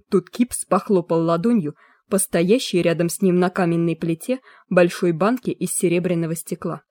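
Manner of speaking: usual speaking voice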